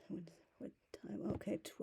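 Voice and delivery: whispered question to herself; breathy aside under the breath. Real, not acted. Muttering to herself about time.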